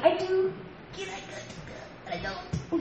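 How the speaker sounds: high claim dropping into hushed doubt; torn back and forth. Acted. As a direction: waver from high voice to whisper and back. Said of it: "just like this back and forth".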